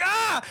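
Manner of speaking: sudden high yelp of pain; a boy hit across the back yelps high and sharp. Retold or imitated. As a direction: cry out as the belt lands. Getting hit by a belt.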